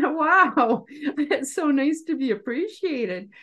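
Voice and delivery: retold thanks through a laugh; grateful laughing. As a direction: laugh out a touched "wow", voice lifted.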